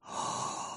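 soft, breath-only mock gasp; hushed theatrical gasp; gasp of realization. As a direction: draw in a quiet, amazed breath. Gasps at the brain's idea.

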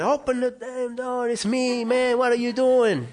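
high-pitched mock yell; impatient calling out. Brother calling through a locked door, high and impatient. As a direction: imitate someone hollering to be let in.

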